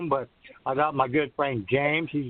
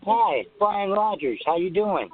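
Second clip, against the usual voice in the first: over the phone; 4 semitones higher than usual. Real, not acted. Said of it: "I couldn't quite hear you".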